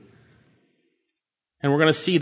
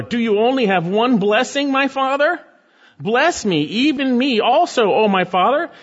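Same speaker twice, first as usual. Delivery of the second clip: weeping plea; 7 semitones higher than usual. Acted. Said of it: "Esau lifted up his voice and he wept".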